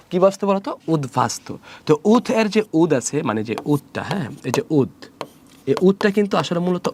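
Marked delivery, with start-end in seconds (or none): 0.9-1.6 "উদ্ভাস্ত" as ud-bastu with clear b; b-phala kept. Shown as a demonstration. Teacher answering own question, "উদ্ভাস্ত" as ud-bastu. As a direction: keep the d-b join audible in "উদ্ভাস্ত". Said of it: "অক্ষত থাকবে".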